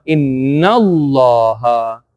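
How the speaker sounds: INNALLAHA run together, the first A of Allah dropped, long LAA; connected pronunciation. Shown as a demonstration. Teacher shows how inna joins Allah, slowly linked as one word. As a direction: join inna straight into a slow, stretched Allah. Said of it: "we would not pronounce this thing".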